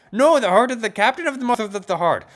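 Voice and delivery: high, loud coach voice; muddled, excited coach. Acted. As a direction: argue loudly through the confusion.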